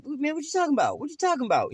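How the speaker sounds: defensive denial. Retold or imitated. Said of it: "You like".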